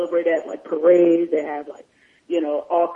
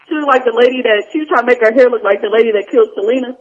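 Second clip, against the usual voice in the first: ordinary talk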